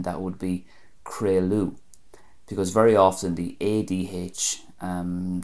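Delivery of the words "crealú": "crealú" as KRAY-loo; Ulster pronunciation. Shown as a demonstration. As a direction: say "crealú" ending on a U sound. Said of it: "transitions into a U sound".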